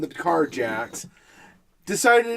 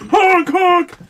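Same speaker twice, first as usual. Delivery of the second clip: loud high honk imitation; mock car horn; 9 semitones higher, 6 dB louder than usual. Drivers honking past the stalled car, loud mock honk. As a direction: blare it like a horn. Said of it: "People are like".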